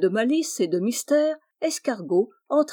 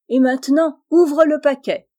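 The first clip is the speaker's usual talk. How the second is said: louder, higher urging; eager; 6 semitones higher, 9 dB louder than usual. A narrator's reading. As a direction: push him to open it. Eager friends at the party. (Blonde Abeille presse Rosario, excitée, plus forte et plus aiguë)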